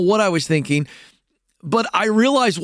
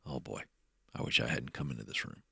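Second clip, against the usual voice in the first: much softer and lower, muttered to himself; dreading mutter; 20 dB quieter than usual. Retold or imitated. Someone regretting entering a room, muttered much softer. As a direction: drop to a sinking under-breath groan.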